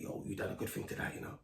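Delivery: put-on whispered words of an older man in the car; hushed advice. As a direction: lean in and whisper it. Older man's quiet advice in car.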